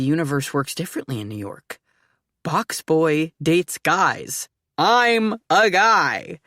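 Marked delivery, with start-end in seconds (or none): 2.4-4.5 drawn-out, dawning thought; slow realisation. Acted. 4.8-6.4 excited. Acted. Excited blurt of interest.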